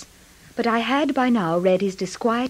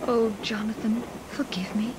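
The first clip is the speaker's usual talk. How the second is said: soft voiceless plea for forgiveness; whispered plea; 5 dB quieter than usual. Acted. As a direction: breathe it like a guilty confession. Wife asks absent husband's pardon.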